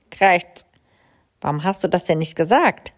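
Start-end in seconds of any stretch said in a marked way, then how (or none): 0.1-0.7 loud caw. A narrator's reading. Crow caws.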